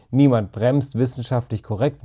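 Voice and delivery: usual speaking voice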